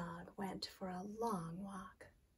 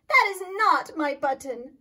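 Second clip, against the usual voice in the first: loud, higher angry shout; 6 semitones higher, 15 dB louder than usual. Acted. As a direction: yell it at Frog. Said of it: "shouted Toad".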